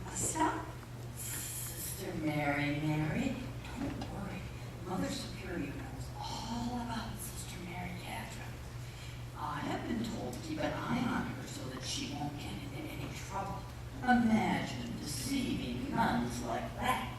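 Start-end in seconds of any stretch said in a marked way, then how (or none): 1.2-3.3 hushed, lower whisper of Sister Mary, Mary. Acted. Whispered wake-up. 3.8-13.6 hushed whisper. Acted. Secret told beside sleeping sister.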